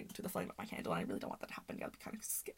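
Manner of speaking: whispered aside to herself. Real, not acted. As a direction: drop to a whisper. Aside about fly near candle.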